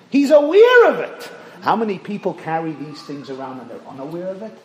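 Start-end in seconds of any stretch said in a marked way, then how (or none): 0.1-1.3 repeated louder and higher, aware punched and falling; preacher driving home the point, He's aware of it loudly; emphatic shout. Shown as a demonstration. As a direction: call out He's aware of it with force.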